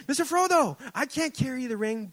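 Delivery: high, loud attempt at a Samwise accent; a preacher half-failing a Samwise accent. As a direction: call out to Frodo in a rough try at Sam's accent. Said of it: "I can't do the accent".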